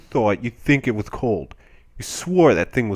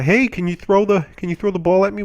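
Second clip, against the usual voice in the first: higher-pitched retold call; 8 semitones higher, 4 dB louder than usual. Billy asks the guy for the ball, calling out. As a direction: imitate a kid calling for the ball.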